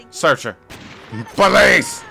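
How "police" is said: loud high shout. Acted. Gamer playing cop announcing himself. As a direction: bark it like a cop.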